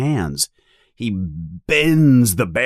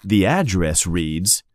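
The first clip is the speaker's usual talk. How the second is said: ordinary delivery, nothing marked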